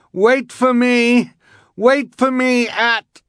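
high, slow desperate shout. A narrator's reading. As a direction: call out while being swept away. Quigley calling while swept away.

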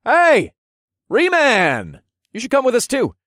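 high, loud shout to a friend; calling out. Acted. Calling to a friend lounging next door, loud high holler. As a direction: holler across to the next room. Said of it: "I called to Riondale".

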